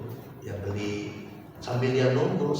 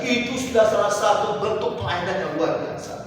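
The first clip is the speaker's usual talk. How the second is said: higher, rising to a loud call; loud pulpit appeal; fervent preaching; 8 semitones higher, 6 dB louder than usual. Acted. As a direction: urge the congregation to speak of Jesus. Preaching at full force.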